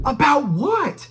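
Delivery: high, loud, drawn-out disbelief; incredulous outburst. Retold or imitated. Driver reacting to officer admitting he lied, incredulous. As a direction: stretch it out, swooping up in indignant shock.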